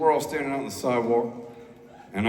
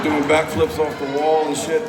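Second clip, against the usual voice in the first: ordinary talk, nothing marked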